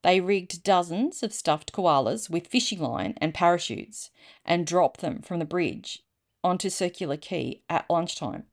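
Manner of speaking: ordinary delivery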